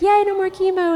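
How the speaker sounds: high, loud mock crowd cheer; party cheer. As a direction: imitate everybody cheering at a party. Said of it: "everybody was like".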